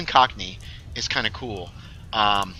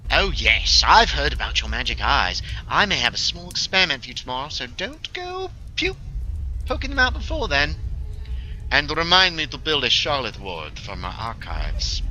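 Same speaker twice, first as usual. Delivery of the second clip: put-on Cockney accent; 3 semitones higher than usual. Character discussing magic eyes with a colleague, Cockney accent. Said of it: "you're doing a Cockney accent".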